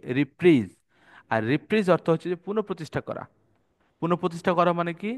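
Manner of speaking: normal talking voice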